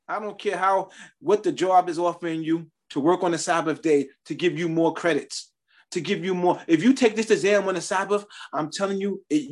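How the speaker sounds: ordinary talk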